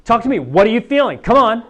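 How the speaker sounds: mock insistent breakup plea; pleading partner. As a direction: demand the ex open up. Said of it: "when you break up with someone".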